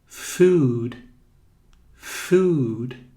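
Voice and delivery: "Food" with a long stretched u; drawn-out oo. Shown as a demonstration. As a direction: hold the vowel in "Food". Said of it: "Igual quando você pronuncia".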